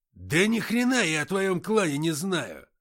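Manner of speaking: slow, loud high furious shout. Acted. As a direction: bang the table and yell. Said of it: "крикнул Антоха".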